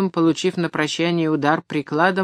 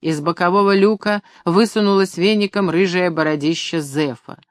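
usual speaking voice

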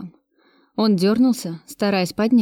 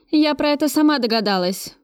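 a lightly voiced bark, a bit higher; mild bark; 6 semitones higher than usual. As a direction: snap at the captive, understated. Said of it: "рявкнула я".

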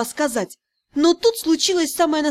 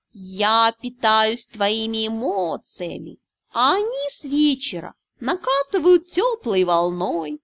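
smug lazy cat; slower than usual. Acted. Cat lecturing the heroine, smug and drawling. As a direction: purr it out like a pleased lazy cat.

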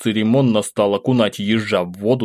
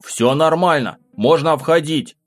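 calling out; 6 semitones higher than usual. A narrator's reading. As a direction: call them in from inside. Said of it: "крикнул я".

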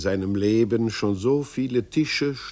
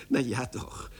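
giggling; 4 dB quieter than usual. Acted. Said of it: "kicherte das Männchen".

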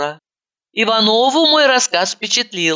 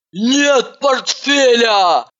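slow, dragged-out hysterical cry; hysterical shout; 5 semitones higher, much slower than usual. A narrator's reading. Ivanova bursting out of the funeral office, hysterical. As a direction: wail it in panic. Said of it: "истерично закричала она".

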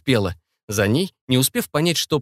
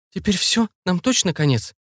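anxious question; 7 semitones higher than usual. A narrator's reading. Fearing the crash is certain.

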